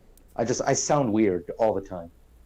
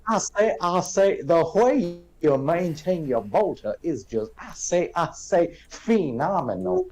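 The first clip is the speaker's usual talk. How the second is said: mock Southern gentleman; cartoon Southern accent; 6 semitones higher than usual. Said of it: "southern impersonation of a cartoon".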